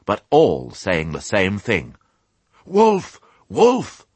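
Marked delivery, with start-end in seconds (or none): 2.6-4.1 high, louder cry of alarm; dazed, confused cry. A narrator's reading. Flock of sheep cornered by dogs, dazed high cry. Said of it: "cried the flock in dazed confusion".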